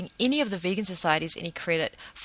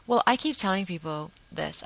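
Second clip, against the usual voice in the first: normal talking voice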